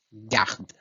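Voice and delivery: garde with weight on the A, closing on D; strong syllable. Shown as a demonstration. Teacher illustrates strongest syllable with a vowel, stressed garde. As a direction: lean on the vowel of garde and sound the D. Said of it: "a sílaba mais forte".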